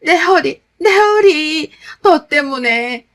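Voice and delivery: loud, high cries of pain, slowing into blame; agonized moaning.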